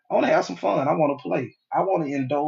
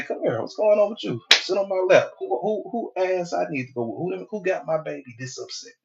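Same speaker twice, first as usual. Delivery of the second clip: tender comforting; 5 semitones higher than usual. Retold or imitated. Comforting his upset partner.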